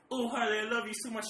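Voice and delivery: high, loud gushing over a dog; mock gushing love. Showing the owner's mood swing with the dog, gushing affection. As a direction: gush adoringly at full volume. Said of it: "You go from being like".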